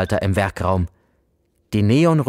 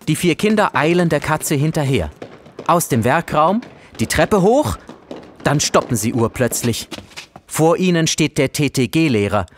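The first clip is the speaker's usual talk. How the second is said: ordinary delivery, nothing marked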